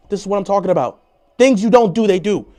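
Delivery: loud, fed-up complaint; exasperated rant. Real, not acted. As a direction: raise your voice at the team. Rant at offside penalty.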